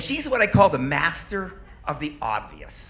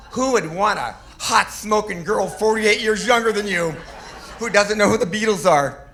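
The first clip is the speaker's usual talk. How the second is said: mock indignation; 5 semitones higher, 5 dB louder than usual. Mock outraged rhetorical question.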